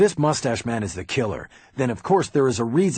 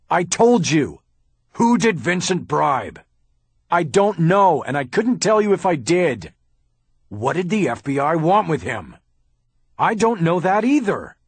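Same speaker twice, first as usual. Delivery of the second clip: loud, much higher exasperated yelling; shouting match; 9 semitones higher, 7 dB louder than usual. Acted. Haller in a shouting match with Bosch, furious. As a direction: fire each answer straight back.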